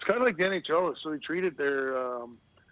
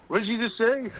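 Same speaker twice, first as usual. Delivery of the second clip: mock angry retort; 8 semitones higher, 4 dB louder than usual. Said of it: "he turned around and told me".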